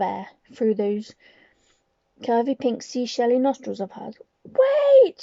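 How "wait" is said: high, loud shout. Acted. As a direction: shout to stop everyone. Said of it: "she shouted again".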